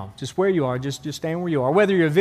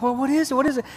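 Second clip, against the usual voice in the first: eager men asking; 8 semitones higher, faster than usual. Acted. Other men press him.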